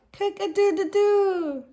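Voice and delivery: high sing-song rooster crow. Acted. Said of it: "crowing his good morning song".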